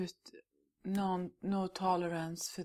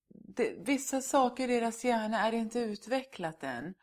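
usual speaking voice

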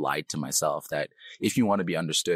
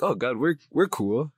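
higher, relaxed and happy Nigerian English; happy Nigerian accent; 8 semitones higher, 4 dB louder than usual. Acted. Happy believer praying to God, Nigerian accent. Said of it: "then I speak with my Nigerian accent".

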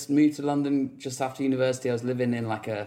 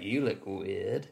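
low, slow, softer mock verdict; deadpan stranger; 5 semitones lower, 7 dB quieter, much slower than usual. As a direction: drop it slowly, low and blunt. Voicing a stranger's blunt remark.

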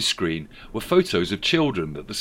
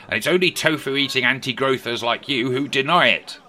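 raised, boastful character voice; Rishi Scrooge voice; 5 semitones higher, 5 dB louder than usual. A narrator's reading. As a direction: puff up like a smug politician.

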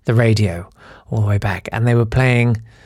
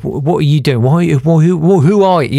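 louder, stammering mock challenge; imitated suspicious challenge; 3 semitones higher, 4 dB louder than usual. Policeman catches him breaking character, spluttered mock challenge.

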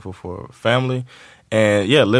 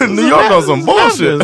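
exasperated; 9 semitones higher, 6 dB louder, slower than usual. Real, not acted. Exasperated loud slow remark.

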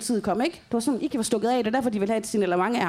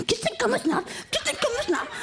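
high sing-song mock Iki; high parroting mock voice; mimicking Iki; 11 semitones higher than usual. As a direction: squeak it out like a stuck record. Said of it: "men han stod sådan der".

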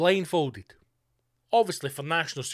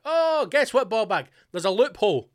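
gloating taunt; 6 semitones higher, 7 dB louder than usual. Pretend. Mock taunting the objecting MP.

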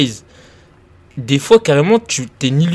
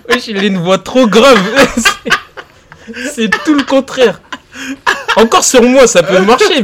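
talking through giggles, high and loud; laughing; 10 semitones higher, 7 dB louder than usual. Real, not acted. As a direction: laugh while protesting. Laughing about the wrong voice.

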